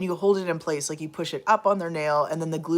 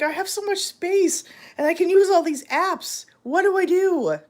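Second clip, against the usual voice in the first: mock friend; 12 semitones higher, 5 dB louder than usual. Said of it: "You're gonna be like".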